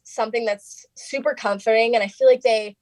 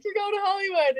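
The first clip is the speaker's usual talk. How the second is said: excited judges; 13 semitones higher, 3 dB quieter than usual. Retold or imitated. Judges stand and announce.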